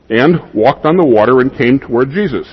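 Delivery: usual speaking voice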